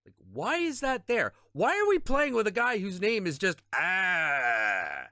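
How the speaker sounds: venting at the useless teammate, getting shriller; angry rant. Real, not acted. Raging at a bad teammate.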